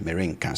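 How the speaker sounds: merenka said in full, softly; formal reading form. Shown as a demonstration. Teacher quoting how Bible readers say it. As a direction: pronounce merenka with the full re syllable. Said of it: "you hear people say merenka".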